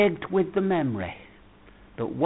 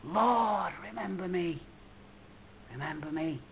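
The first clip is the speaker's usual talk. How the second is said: soft, fading plea from the heart; sincere heartfelt cry; 2 semitones higher, 9 dB quieter than usual. Retold or imitated. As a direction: let it sink to a hushed prayer. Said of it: "a sincere cry from the heart".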